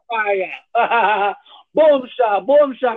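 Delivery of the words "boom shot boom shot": mock Jamaican accent. Mock Jamaican patois exchange.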